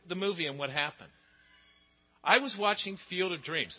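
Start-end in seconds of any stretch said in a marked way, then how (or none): none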